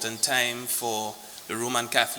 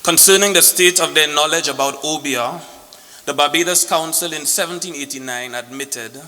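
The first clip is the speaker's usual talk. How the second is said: formal speech; 4 semitones higher, 7 dB louder than usual. Acted. Formal lecture opening, loud.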